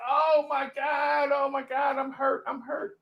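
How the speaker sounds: high, slow hammed-up wailing of a hurt man; hammed-up pain. Dewey fakes being badly hurt to get Babe's attention, hammed-up high wail. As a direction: overplay the injury for sympathy. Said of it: "Dewey like".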